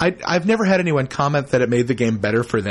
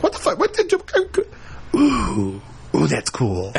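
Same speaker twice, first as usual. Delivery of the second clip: much higher, startled outburst turning to awe; retold shock; 3 semitones higher than usual. Player hit by a sudden scene cut, startled then delighted. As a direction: jolt up in pitch, then settle into an impressed ooh. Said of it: "I was like".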